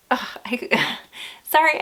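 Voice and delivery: catching on real emotion, laughing it off; start, break off and apologize through a small laugh; choked up. Real, not acted. Mom talking about watching her daughter play, choked up. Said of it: "I could get a little choked up".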